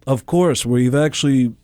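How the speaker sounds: slow, lightly voiced Simmons; deadpan outrageous Gene; [name] impression. As [name] answering a radio host, slow deadpan. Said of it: "And I go".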